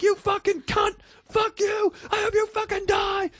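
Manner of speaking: very high screamed abuse; furious yell. Retold or imitated. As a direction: yell it at protesters out of the window. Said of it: "And I went crazy".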